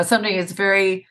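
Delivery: normal talking voice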